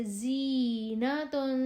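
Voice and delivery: زینتن with a thin, hissing ز, drawn out slowly; زینتن slow, sharp ز; whistling z. Shown as a demonstration. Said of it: "ز کی آواز باریک اور سیٹی والی".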